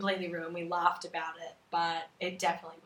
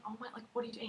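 whisper; 4 semitones higher, 9 dB quieter, much faster than usual. Retold or imitated. Walking in on him vomiting.

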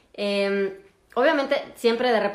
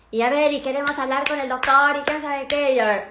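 higher, louder demanding relatives; mock pushy relatives; 5 semitones higher, 4 dB louder than usual. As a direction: imitate the big group insisting. (imitación ligera de familiares exigentes, más agudos y fuertes)

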